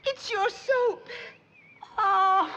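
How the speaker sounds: high, slow, tearful wail; weepy wail. Acted. Tearful neighbor wailing to Granny about the soap. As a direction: wail it out like she's been bawling. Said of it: "dry them tears and tell old Granny what's got you to bawling".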